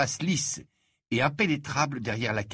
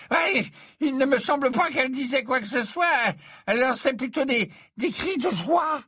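a dithering prophetess confessing reluctantly; flustered seer; 11 semitones higher than usual. Acted. Trelawney describes the voice.

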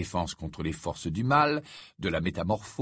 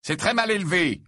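sharp, higher-pitched telling-off; curt reproach; 9 semitones higher, 4 dB louder than usual. A narrator's reading. Ron to a tiny first-year pupil, curt. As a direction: snap it drily, pitched up. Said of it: "lança sèchement Ron".

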